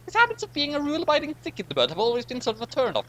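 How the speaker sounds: very high, catty aside; high-voiced catty aside about Riker; high character voice. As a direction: pitch way up and tease him. Same high character continues.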